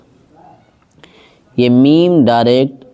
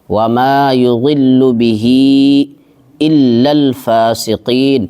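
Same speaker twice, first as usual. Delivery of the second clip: slow, drawn-out tajweed chant; Quran recitation; much slower than usual. Shown as a demonstration. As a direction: recite each word long and measured. Recites verse slowly. (تجوید کے ساتھ آہستہ تلاوت)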